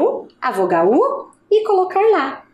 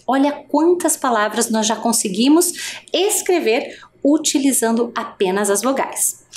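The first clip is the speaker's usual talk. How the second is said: ordinary delivery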